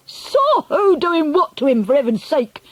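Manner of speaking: exasperated shout. A narrator's reading. Father demands sense from the grandmother, exasperated shout. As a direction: bellow it word by word. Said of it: "shouted Mister Jenkins".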